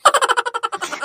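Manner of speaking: a sudden high burst of laughter. Acted. Mother-in-law laughing off her daughter-in-law's taunt, high laugh.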